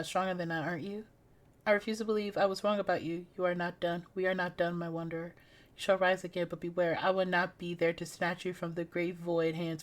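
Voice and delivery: low, hushed, solemn guardian; mysterious guardian voice. Acted. Guardian spirit speaking to the fallen wanderer, low and hushed. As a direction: speak softly as an ancient watcher. Voice of a game figure.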